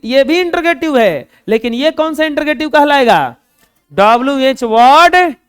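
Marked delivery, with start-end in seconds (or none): none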